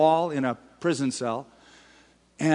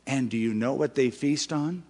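ordinary talk

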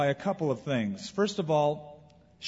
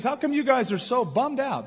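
higher, casual Jesus asking the two; lightly voiced Jesus, raised pitch, friendly question; Jesus asking the sad travellers, higher and casual; 8 semitones higher than usual. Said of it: "He goes".

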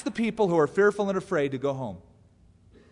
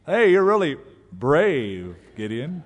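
loud, slow mock admiration, dropping on the name; tongue-in-cheek praise; 5 dB louder, slower than usual. Angel teases timid Gideon about his bravery, tongue-in-cheek. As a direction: draw out the compliment as an inside joke. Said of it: "It's kind of tongue-in-cheek".

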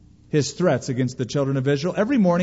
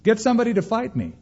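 higher, hammed-up call up the slope, fast at the end; mock giant's taunt; 7 semitones higher than usual. Goliath in the valley taunting Israel's army, mock higher call. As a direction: toss a bragging dare uphill, playfully. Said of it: "shouting up to the mountain".

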